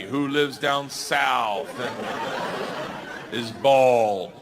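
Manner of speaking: very slow, stretched with a laugh; milk every word slowly; drawn-out punchline. Acted.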